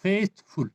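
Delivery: faithful split into faith and ful; syllable by syllable. Shown as a demonstration. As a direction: say faithful as two separate beats, faith, ful. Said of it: "faith, one syllable". (faithful को दो अलग हिस्सों में बोलें)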